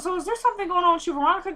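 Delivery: pitched high and fast, teasing; taunting sister. Retold or imitated. Sister teasing brother about Veronica, high mocking voice. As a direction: needle him in a sing-song.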